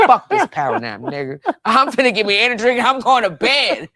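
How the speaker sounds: loud, laughing outburst, rising high; mock exasperation. Tired worker rejecting the power nap, loud mock-exasperated and laughing. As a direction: throw up a hammed-up refusal, stretching the ending.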